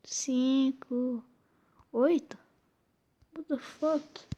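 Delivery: normal talking voice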